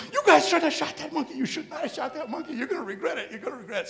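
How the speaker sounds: very high-pitched imitated outburst; mock hysterical scolding. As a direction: wail a warning in a put-on high voice. Ernie's upset outburst, retold.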